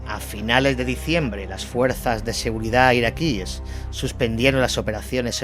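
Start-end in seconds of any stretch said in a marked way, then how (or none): none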